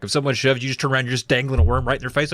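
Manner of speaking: ordinary delivery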